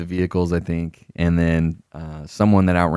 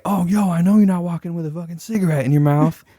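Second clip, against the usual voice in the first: mock angry officer; 10 semitones higher than usual. Superior catches him smoking.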